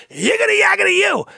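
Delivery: very high, loud nonsense shout; gibberish yell. Acted. Poking Santa in the eye, yelling gibberish. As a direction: yell it in triumph.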